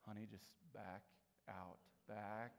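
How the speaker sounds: soft, low urging to just back out; quietly coaxing your partner to leave; hushed backing-off plea. Retold or imitated. Shopper hushing spouse, soft low urging to back out. Walking into a store fight.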